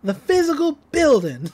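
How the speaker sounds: a hint of a spoiled boy insisting; Gideon ranting. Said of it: "he's like".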